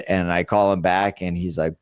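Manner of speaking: ordinary talk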